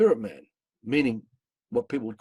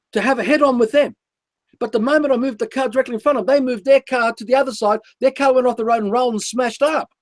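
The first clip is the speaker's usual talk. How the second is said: louder, driven telling of the car chase; raised, urgent voice recounting the head-on swerve; loud intense storytelling; 8 semitones higher, 9 dB louder than usual. Acted. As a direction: push every line out like it still shocks you. Intense retelling of assassination attempt.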